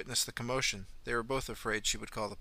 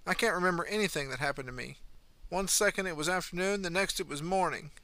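put-on young mother, upset; 6 semitones higher than usual. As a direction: plead your case, voice pitched up and pushing. Young mother pleads her innocence.

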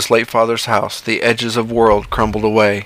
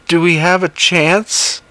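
higher, slow fearful question; John's voice; 7 semitones higher than usual. Acted. John asks the creature about hope, high and slow.

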